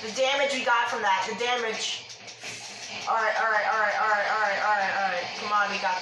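steady yourself out loud; alarmed, urgent. Real, not acted. Reeling from dragon damage.

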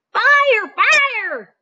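a cat trying to scare the birds off; crying out. Acted. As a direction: yell the false alarm. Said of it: "he cried".

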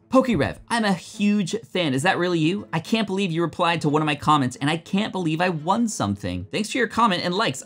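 starstruck fan. Pretend. Fan gushing at fake Pokerev, high and starstruck. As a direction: gush at your idol.

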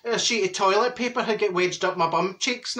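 ordinary talk

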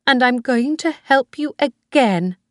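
put-on a high old woman pleading with her son; old Mrs Harvey. Old woman voiced by reader.

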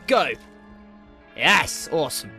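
ordinary delivery

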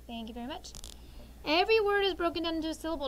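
ordinary talk

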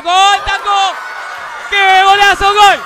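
screaming goal call, long held golazo; breakneck goal commentary. Real, not acted. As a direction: yell the goal with everything.

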